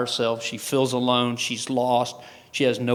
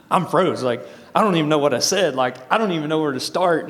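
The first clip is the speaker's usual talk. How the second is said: louder retold fluster; mock panic; 4 semitones higher, 4 dB louder than usual. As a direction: relive freezing up, louder and a bit higher. Reliving freezing on stage before crowd.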